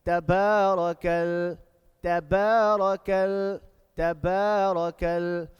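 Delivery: slow, loud recitation with stretched vowels; measured, sustained tajweed delivery; loud Quran recitation. Shown as a demonstration. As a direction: chant it out word by word for a class to repeat. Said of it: "Now three times outside".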